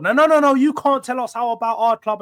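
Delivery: high, loud defensive fan; mock the fans protesting loudly. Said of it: "we saw how defensive they got".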